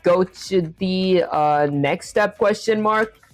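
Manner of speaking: slowly spelling out a typed message; slow dictation. Real, not acted. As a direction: dictate slowly while typing. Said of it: "That said".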